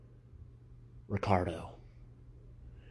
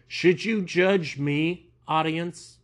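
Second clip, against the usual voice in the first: mock plea; 7 semitones higher, 5 dB louder, slower than usual. Mock question to the audience.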